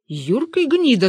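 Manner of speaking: high, angry cry; angry shout. A narrator's reading. Father-in-law curses the escaping quarry, angry shout. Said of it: "закричал тесть".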